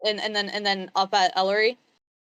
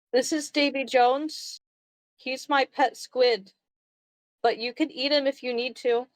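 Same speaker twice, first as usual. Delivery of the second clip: slightly higher, hesitant little creature voice; earnest little Ozzy; 4 semitones higher than usual. Acted. Ozzy offering his pet squid to Ellery, earnest and higher-pitched.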